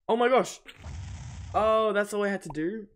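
surprised, loud and high; excited. Real, not acted. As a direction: blurt it out in amazed relief. Sudden discovery of easy solution.

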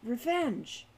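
understated dawning horror; horrified. A narrator's reading. Falcon realizing the kobold's deed, in horror. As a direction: say the word as horror sinks in. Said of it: "he said in horror".

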